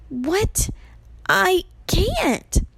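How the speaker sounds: high, hushed disbelief; alarmed refusal. A narrator's reading. Percy is told to kill Medusa, alarmed and high. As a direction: balk in mild panic.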